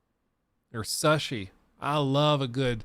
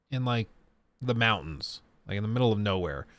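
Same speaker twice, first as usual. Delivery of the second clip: ordinary delivery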